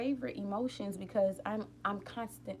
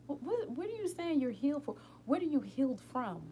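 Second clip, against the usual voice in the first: incredulous challenge; 7 semitones higher, 3 dB louder than usual. Acted. Challenging those who claim healing.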